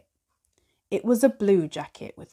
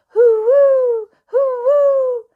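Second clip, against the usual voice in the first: hoo-woo wind howl, much higher and louder, falling; howling wind noise; 10 dB louder, slower than usual. Acted. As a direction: howl like a snowstorm gusting.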